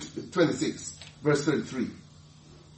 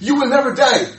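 loud protest; 6 semitones higher, 8 dB louder than usual. Retold or imitated. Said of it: "he turned to Jesus and said".

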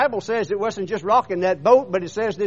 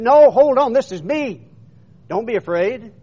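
voiced quote, raised at the start, then easing; calling out reassurance; 5 semitones higher, 7 dB louder than usual. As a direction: call over the waves to settle frightened men. Retold Jesus calming the frightened crew.

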